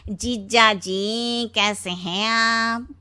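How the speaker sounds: very slow, drawn-out put-on voice; Shinu. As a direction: greet your brother-in-law with every word stretched out. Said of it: "Sheena का भाई शीनू आ जाता है". (खींच-खींचकर बोलता बनावटी साला)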